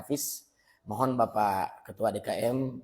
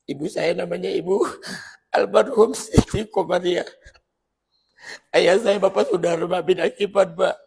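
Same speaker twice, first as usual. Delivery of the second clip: raised, higher, pleading voice; emotional plea; 8 semitones higher, 9 dB louder than usual. Real, not acted. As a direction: beg forgiveness for the late parents. Emotional prayer for late parents.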